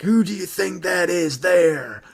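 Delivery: bad American accent, slow; crap American accent. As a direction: put on a clumsy American accent. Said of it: "in a crap American accent".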